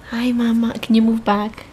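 ordinary delivery

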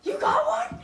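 high, loud disbelieving question; can't believe his brother did it; amazed. Retold or imitated. Brother staring at the deer, high amazed question. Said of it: "And he looks at".

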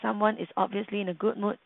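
quiet, quick aside; soft whisper. Shown as a demonstration. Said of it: "[name] whispered".

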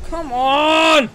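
slow, loud drawn-out groan of anger; exasperated shout. Real, not acted. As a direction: let the frustration burst out. Rival blocks again.

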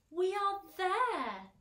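high, loud, drawn-out announcement; trying to be cheerful. A narrator's reading. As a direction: proclaim it slowly to cheer everyone up. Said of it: "cried the old green grasshopper, trying to be cheerful".

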